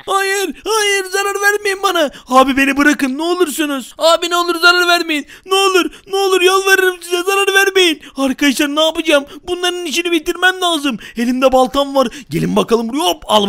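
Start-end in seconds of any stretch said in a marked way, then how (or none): 0.1-8.0 higher, crying, shouted begging; desperate pleading. Acted. Baby TV man screaming to the villains to let him go. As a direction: cry out for mercy, desperate.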